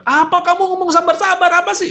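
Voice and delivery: very high, loud indignant yell; angry retort. Shown as a demonstration. Angry person rejecting the advice to be patient, loud high outburst. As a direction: explode back at the calming voice. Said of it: "Wah, di sini terus".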